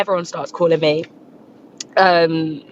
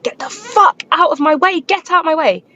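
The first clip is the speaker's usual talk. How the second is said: retold panicked yell; 9 semitones higher than usual. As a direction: re-enact yelling at someone blocking the car. Retold panicked yelling while beeping.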